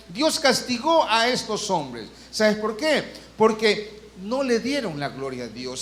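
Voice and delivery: usual speaking voice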